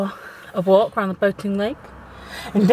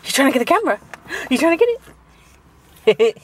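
put-on dog baby talk; 5 semitones higher, 4 dB louder than usual.

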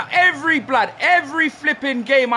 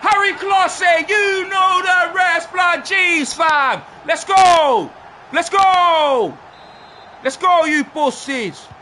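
high, loud, slow roar of joy; real triumphant yelling; celebratory shouting; 9 semitones higher, 6 dB louder, much slower than usual. Real, not acted. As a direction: hype the team up after scoring. Scored a goal, celebrating loudly.

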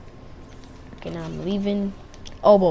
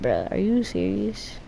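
imitated disbelief; slower than usual. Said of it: "I'm like".

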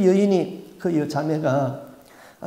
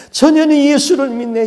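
much higher and louder wailing repeat; the demon howling it louder; louder lament; 9 semitones higher, 9 dB louder than usual. Retold or imitated. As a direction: let the grief burst out on the repeat. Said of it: "막 울면서 통곡을 하는데".

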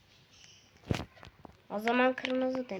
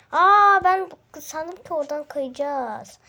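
higher, louder, slow surprise; surprised exclaim; 5 semitones higher, 10 dB louder, slower than usual. Real, not acted. Gamer surprised by the map to viewers, loud. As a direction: caught off guard by the slide.

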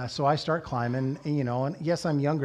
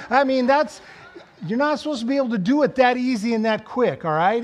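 louder, higher, indignant disbelief; incredulous outburst; 7 semitones higher, 7 dB louder than usual. Retold or imitated. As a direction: exclaim it like you can't believe how easy he made it. Incredulous rant at climber.

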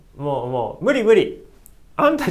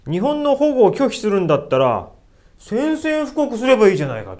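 angry challenge; 6 semitones higher, 6 dB louder than usual. Retold or imitated. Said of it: "ものすごい剣幕で".

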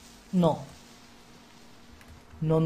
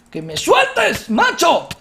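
high, loud shout of frustration; exasperated shout; angry shout; 6 semitones higher, 3 dB louder, much slower than usual. Real, not acted. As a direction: yell to be let go. Shouting at the mob.

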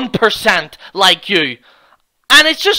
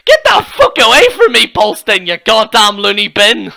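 overblown, husky impression of his threats; mocking porn-star voice. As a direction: ham up the threat to mock him.

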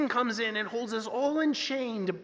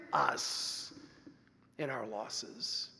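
tender; 8 semitones lower, 8 dB quieter, much slower than usual. Acted. Tender close of sentence.

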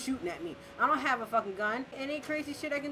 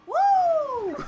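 high, loud whoop; long thrilled whoop; exhilarated whoop; 13 semitones higher, 8 dB louder than usual. Real, not acted. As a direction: let out a big joyful holler. Exhilarated by high-speed chase.

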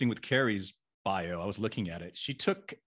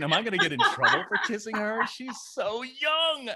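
high-pitched, hammed-up worry; mock panic; 11 semitones higher than usual. Host retelling worry about kissing young co-star, mock panic. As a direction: fret in a squeaky mock alarm, drawing out the end.

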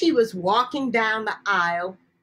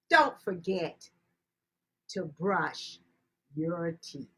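soft reminder; 5 semitones lower, 6 dB quieter than usual. A narrator's reading. Storyteller to children, soft and tender. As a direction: drop to a soft, gentle hush. Gentle closing advice to children.

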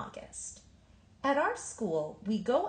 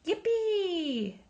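much higher, gleeful yippee; joyful sledding shout; excited whoop; 12 semitones higher, 3 dB louder than usual. A narrator's reading. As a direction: cheer like a kid flying downhill. Jumping on sleds downhill.

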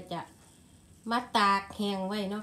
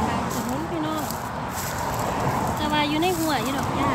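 excited; 5 semitones higher, 6 dB louder than usual. Real, not acted. Counts mushrooms while picking, excited.

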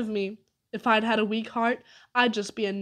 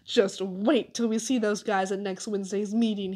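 plain reading voice

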